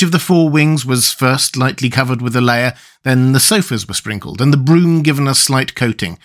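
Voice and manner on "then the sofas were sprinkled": ordinary delivery, nothing marked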